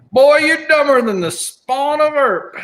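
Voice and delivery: put-on high, loud, slow drunken holler; mock drunk yell. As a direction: play a rowdy Tombstone drunk hollering an insult. Drunk person heard outside.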